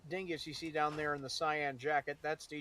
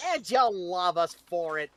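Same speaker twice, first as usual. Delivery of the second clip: mock Brooklyn tough-guy swagger; hammed-up New York accent, louder; 4 semitones higher, 8 dB louder than usual. Boasting to the chat in a loud Brooklyn accent. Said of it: "You going full Brooklyn?".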